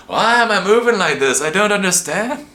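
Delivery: high, loud mock panic; hammed-up, helpless wail of confusion; mock bewilderment. Person being steered without knowing why, in mock high panic.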